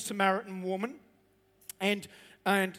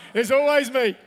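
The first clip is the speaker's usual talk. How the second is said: shouted out loud with a laugh; 3 semitones higher, 8 dB louder than usual. Real, not acted. Congregation member heckling the preacher, loud and laughing. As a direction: yell it from the crowd, teasing. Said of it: "I was gonna think it".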